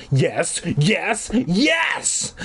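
excited cheering. A narrator's reading. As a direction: build to a high, gleeful yes. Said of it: "shouting like a maniac".

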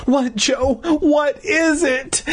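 high, slow anxious coaxing; soft demand. A narrator's reading. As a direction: ask tenderly in a high girl's voice. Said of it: "Mindy demanded softly".

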